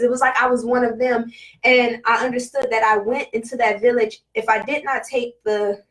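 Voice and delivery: ordinary talk, nothing marked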